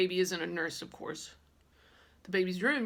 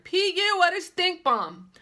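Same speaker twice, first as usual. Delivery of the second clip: much higher, loud, slow holler; hollered with nose held; 12 semitones higher, 9 dB louder, slower than usual. Retold or imitated. Girl hollering at a smelly baby at Grace's, loud slow shout. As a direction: shout it holding your nose. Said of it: "I held my nose and hollered".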